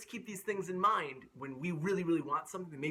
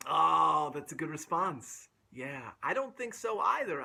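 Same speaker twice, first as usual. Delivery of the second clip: plain reading voice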